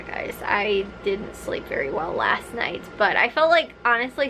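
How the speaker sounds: ordinary talk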